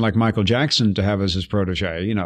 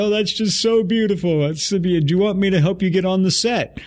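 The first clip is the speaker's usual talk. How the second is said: crying lady; 8 semitones higher than usual. Retold or imitated. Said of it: "this lady starts crying".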